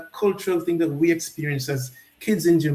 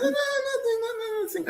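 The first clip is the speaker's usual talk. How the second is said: much higher, softer coy brush-off; sheepish high nothing, nothing; coy denial; 5 dB quieter than usual. Retold or imitated. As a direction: wave it off, bashful and high. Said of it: "I said".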